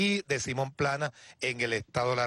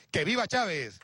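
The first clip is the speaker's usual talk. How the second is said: ranting dictator speech; 4 semitones higher than usual. Real, not acted. Closing rally cheer to crowd.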